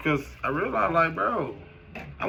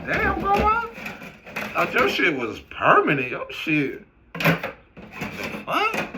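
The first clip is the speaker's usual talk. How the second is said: mock shocked child; 7 semitones higher than usual. Young him shocked at grandma's teeth, high and astonished. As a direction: gasp like a stunned kid. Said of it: "I'm like".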